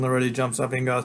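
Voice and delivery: ordinary delivery, nothing marked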